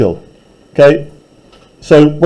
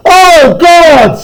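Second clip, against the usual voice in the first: high, loud horrified cry; loud, high terrified Oh God; screaming in terror; 12 semitones higher, 4 dB louder than usual. Acted. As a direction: scream it in horror. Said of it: "again and again".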